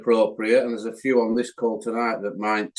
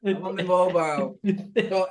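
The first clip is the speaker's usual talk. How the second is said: lighter teasing echo; a hint of mimicry, higher; mimicking Gaz; 6 semitones higher than usual. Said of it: "that was funny".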